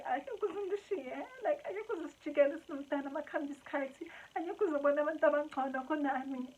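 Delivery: high-pitched, pleading mock wail; hammed-up tearful pleading; childish whine. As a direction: whine insecurely like a clingy child. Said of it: "so childish".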